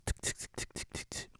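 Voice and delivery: whispered tick tick of a lock rattling; soft unvoiced ticking of a picked lock; imitated ticking. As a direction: tick it out under the breath.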